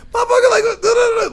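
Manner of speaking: very high, loud babble; mock informal outburst; casual code-switch. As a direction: burst out of proper English into squawked street talk. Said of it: "it's a completely different thing".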